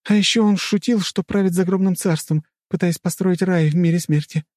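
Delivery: high-pitched urgent heroine calling out; excited shout. A narrator's reading. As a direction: cry out the sudden recollection. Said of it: "выкрикнула Рэй".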